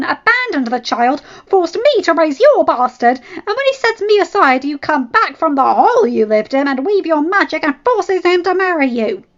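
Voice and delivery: high, loud, raging rant; betrayed wife raging at the other woman; furious tirade. Acted. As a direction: pour out every grievance in fury.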